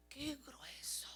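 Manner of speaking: slow, hushed aside; awed whisper. Acted. Preacher reacting to Jesus's hard answer, slow whisper. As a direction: whisper it slowly, as if stunned by the answer.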